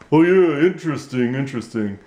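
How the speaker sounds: impressed puppet, trailing off; puppet reacting.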